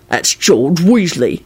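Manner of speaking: slow, dour proclamation. A narrator's reading. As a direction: draw the name out dourly. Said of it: "he proclaimed dourly".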